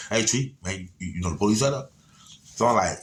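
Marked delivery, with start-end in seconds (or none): 0.1-1.9 lightly voiced impression of the cousin's way of talking; imitating cousin Dontero. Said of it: "That's how he talk".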